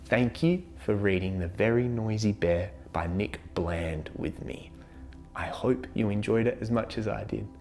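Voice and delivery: ordinary delivery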